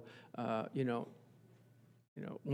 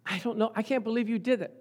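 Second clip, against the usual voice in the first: high, scolding outburst; voice number three; 7 semitones higher, 8 dB louder than usual. Shown as a demonstration. Scolding someone at home for what they did, high and outraged. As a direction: start with a loud high yell, then stay high and incredulous. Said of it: "Voice number three is, like, more octave".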